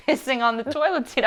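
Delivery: ordinary delivery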